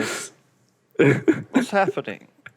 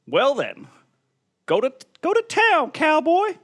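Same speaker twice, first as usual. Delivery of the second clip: higher and louder seductive call; high flirty woman; 13 semitones higher, 4 dB louder than usual. Acted. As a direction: sing it out high and inviting. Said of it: "all friendly like and says".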